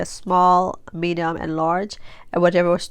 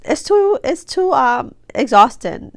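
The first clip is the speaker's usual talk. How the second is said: exasperated; 7 semitones higher, 5 dB louder, slower than usual. Real, not acted. Exasperated about tiring work.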